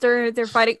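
ordinary talk

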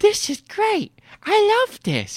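high-pitched mock of a delighted boy; put-on kid voice. Retelling the boy's reaction to the little man, put-on high kid voice. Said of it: "He doesn't sound like that".

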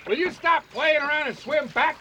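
shouted name across the water; calling out. Acted. Fisherman yelling to partner overboard, calling out. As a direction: call him back to the boat.

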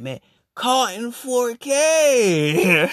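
slow, high, loud mocking drawl through a laugh; mocking people. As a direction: taunt it slowly, half laughing. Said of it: "People like".

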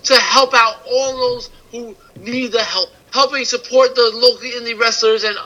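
sponsor read. Acted. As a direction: read the promo like an ad spot.

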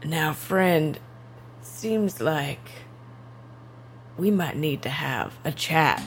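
slow drawl with long pauses; gunslinger character. Acted. Gunslinger confronting the man in the bathroom, slow and quietly menacing. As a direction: draw out the quiet threat, word by word.

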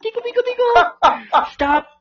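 high, loud wailing complaint; mock shrieking victim. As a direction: squeal like a ruined victim. Prank victim voiced, shrieking.

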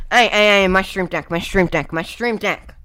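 loud repeated "hey, my stream deck"; loud protest. Real, not acted. Vlogger loudly protests someone moving his stream deck. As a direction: call out loudly to stop them.

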